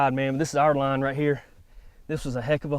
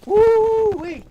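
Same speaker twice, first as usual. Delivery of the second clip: high, loud celebratory whoop; excited whoop; 9 dB louder than usual. Real, not acted. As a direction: whoop it out in triumph. Celebrating the treed cat.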